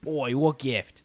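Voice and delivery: put-on puzzled bloke in a British accent. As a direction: play dumb in a British accent. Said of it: "I can imagine this situation going down".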